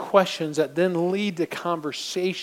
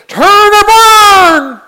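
drawn-out very high shouted street-preacher cry; megaphone preacher; 18 dB louder, much slower than usual. Pretend. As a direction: bellow it through a megaphone. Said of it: "pull out a megaphone and say".

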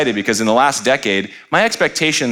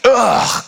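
high mock groan of disgust; a hammed-up scornful ugh; exaggerated huff of contempt; 12 semitones higher than usual. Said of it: "And she's like".

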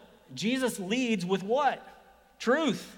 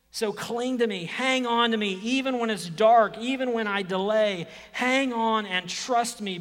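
loud, higher pleading call; preaching at full force; 4 semitones higher, 8 dB louder than usual. Acted. Preacher voicing Jesus, calling loudly on the crowd. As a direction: urge them to hold on, full voice.

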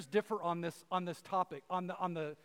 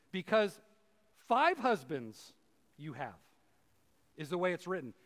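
ordinary talk

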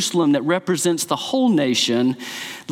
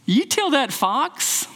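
higher, slow, defiant retort; defiant Jesus; 9 semitones higher, slower than usual. Retold or imitated. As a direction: throw it back defiantly, a touch higher. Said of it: "he never talks like that".

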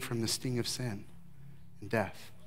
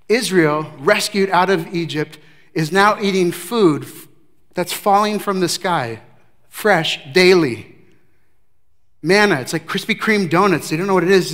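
loud, rising preacher's build to the congregation; impassioned preaching; 5 semitones higher, 10 dB louder than usual. Acted. Pastor preaching to the congregation, loud and fervent. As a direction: preach it out, full force, to the back row.

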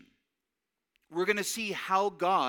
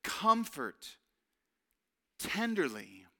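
soft tender words; 3 semitones lower, slower than usual. Shown as a demonstration. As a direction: lower and softer on tenderly. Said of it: "Do you hear those words?".

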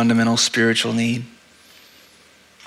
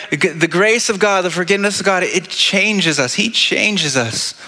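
preaching climax; 6 semitones higher than usual. Real, not acted. Sermon climax, slowing.

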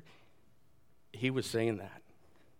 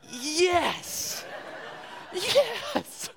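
high, hammed-up cheer of triumph; excited. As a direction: re-enact a thrilled, high-pitched yes. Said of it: "I was so excited".

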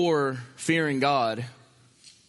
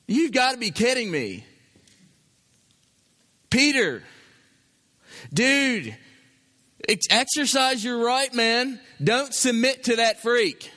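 mock objector; 5 semitones higher than usual. An objector urging Peter to resist, incredulous and heated. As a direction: egg Peter on like an outraged friend.

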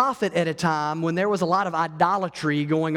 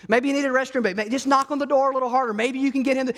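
much higher, teasing mockery; mocking taunt; 8 semitones higher, faster than usual. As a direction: poke fun at the idol's prophets. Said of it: "He's kinda mocking them a little bit".

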